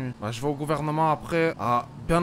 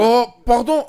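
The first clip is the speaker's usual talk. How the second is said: startled apology; 6 semitones higher, 10 dB louder than usual. Acted. Learns he is the president.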